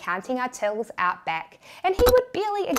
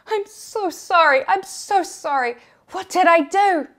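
crying apology; 6 semitones higher, 5 dB louder than usual. Retold or imitated. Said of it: "I burst into tears".

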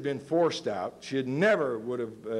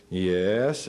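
put-on tired old voice; 6 semitones lower than usual. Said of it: "this old tired voice comes on the line".

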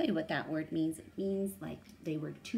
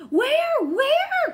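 high, loud calling out; high cry; 13 dB louder than usual. A narrator's reading. Penduli cries out racing after the fleeing crowd. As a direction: cry it out while racing behind. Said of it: "cried Penduli as she raced".

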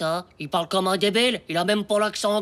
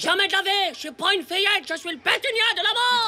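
heroic defiance; 11 semitones higher than usual. Acted. Boy defies lifeguard from diving board.

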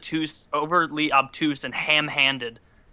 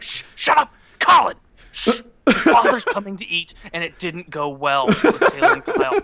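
put-on much higher, louder kid voice hushing a brother; 5 semitones higher, 5 dB louder than usual. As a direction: warn your sibling, dad's in a bad mood.

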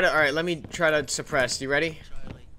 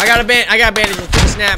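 urgent callout; 6 semitones higher, 11 dB louder than usual. Real, not acted. Calling out under fire.

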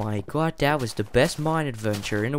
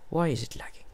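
whisper; 5 semitones higher, 7 dB quieter, faster than usual. Real, not acted. Frustrated gamer whispering to himself.

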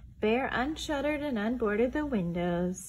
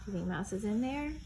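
ordinary delivery, nothing marked

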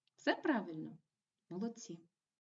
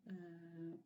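normal talking voice

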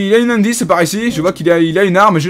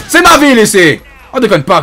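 indignant shout; 5 dB louder than usual. Acted. Cop shot at by a stranger, loud indignant shout. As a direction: yell it to claim your turf.

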